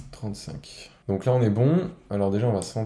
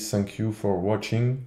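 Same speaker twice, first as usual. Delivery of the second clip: English read with a strong French accent; slow English with a thick French accent. Real, not acted. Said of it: "j'ai un accent anglais incroyable". (accent français en anglais)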